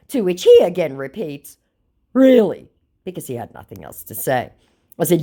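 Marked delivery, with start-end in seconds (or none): 2.1-2.7 mock scammer voice.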